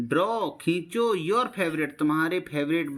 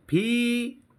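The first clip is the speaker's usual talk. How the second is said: Feather split into फी, long pause, दर; syllable by syllable; 5 semitones higher, much slower than usual. Shown as a demonstration. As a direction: stretch फी high, then a separate दर. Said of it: "आपको बोलना है ऐसे ही मेरी तरह".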